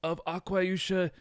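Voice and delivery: a grand, hammed-up pharaoh greeting; terrible pharaoh accent. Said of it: "Seti, the pharaoh ... said".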